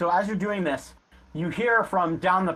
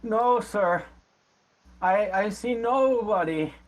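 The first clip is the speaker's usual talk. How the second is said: higher-pitched, halting beggar voice; beggar answering; 6 semitones higher, slower than usual. Acted. Old beggar denies seeing anyone to horseman, pleading voice. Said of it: "And the man goes".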